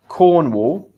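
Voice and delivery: "Cornwall" projected clearly, both syllables full; pronunciation demo. Shown as a demonstration. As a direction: model the word "Cornwall" for learners. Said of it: "it's a little bit hard to pronounce".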